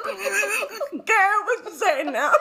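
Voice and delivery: high laughing disbelief. Real, not acted. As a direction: laugh through the question. Laughing at friend's answer.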